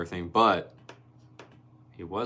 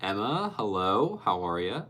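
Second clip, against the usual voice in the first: ordinary talk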